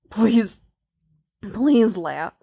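pleading, falling away at the end; rough begging. A narrator's reading. He begs Leia with coaxed words, pleading. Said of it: "even his begging is rough".

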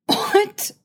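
high wheezed yelp; wheezing. A narrator's reading. Coughing Antilles reacting to the news, wheezing. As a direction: squeeze it out high, as if choking. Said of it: "Antilles wheezes".

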